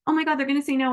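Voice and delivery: imitating her own nervous fear; panicky. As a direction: act out the nerves of a pending no. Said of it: "then I start to get panicky".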